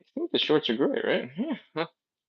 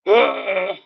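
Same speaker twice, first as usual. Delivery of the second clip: stiff ogre impression; 9 semitones higher, 5 dB louder than usual. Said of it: "stiff ogre impression".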